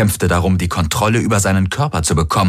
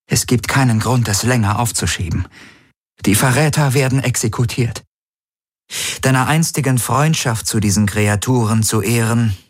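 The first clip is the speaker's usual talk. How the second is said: higher, lightly voiced commander; cool leader over the comm; Ylana, cold and resolved; 6 semitones higher than usual. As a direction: announce the sentence calmly and firmly.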